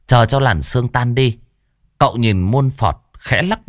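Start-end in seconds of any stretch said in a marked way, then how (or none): none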